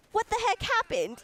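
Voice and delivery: high-pitched mock bewilderment; baffled kid's voice, played up; confused little kid. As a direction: imitate a startled, puzzled child. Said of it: "like, confused".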